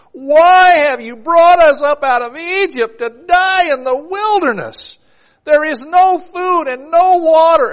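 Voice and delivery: high, loud, slow wailing protest; angry complaint. Acted. Israelites crying out against God, high loud wail. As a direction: cry out like furious starving wanderers. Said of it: "the people spoke against God".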